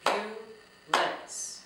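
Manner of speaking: "Ambulance" in three loud, widely spaced beats; very slow syllables. Shown as a demonstration. Teacher clapping out syllables with children, "Ambulance" said very slowly and loudly. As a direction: break "Ambulance" slowly into syllables, pausing after each. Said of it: "say ambulance very slowly".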